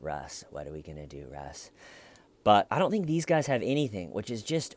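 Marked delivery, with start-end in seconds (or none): 0.0-1.7 low, soft, cocky interrogator; confident interrogator. Retold or imitated. Interrogator with evidence toying with the suspect, soft and low. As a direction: lean back, low and soft, like you already won. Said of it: "be like".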